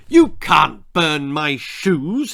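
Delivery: loud, slow outraged bellow. A narrator's reading. Cobbler bellows at the stranger, loud and slow. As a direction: roar it in defiance. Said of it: "he bellowed".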